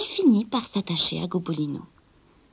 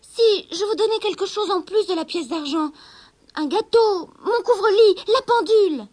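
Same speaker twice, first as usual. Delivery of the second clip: high, louder rushed offers; pleading girl; 11 semitones higher, 6 dB louder than usual. Acted. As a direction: bargain desperately. Rosabelle supplie la sorcière. (jeune fille suppliante, voix aiguë)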